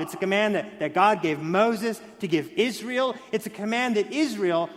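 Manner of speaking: ordinary talk, nothing marked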